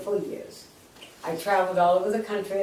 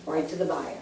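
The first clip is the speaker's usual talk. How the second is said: usual speaking voice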